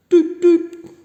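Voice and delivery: high, loud horn toot; playful tooting noise; doot doot horn sound. Pretend. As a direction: toot it like a little car horn. Sound effect in story.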